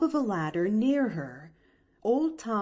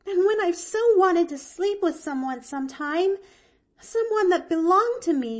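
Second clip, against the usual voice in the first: put-on high, eager little girl; bright child explaining herself; girl's voice; 9 semitones higher, 5 dB louder than usual. A narrator's reading. Girl explaining to her aunt why she slept outside, high. Pollyanna gushing.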